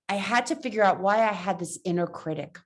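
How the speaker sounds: usual speaking voice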